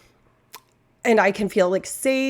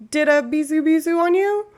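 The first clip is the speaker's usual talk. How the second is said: mock outrage; 9 semitones higher, 5 dB louder than usual. Mock-outraged Alberta aside.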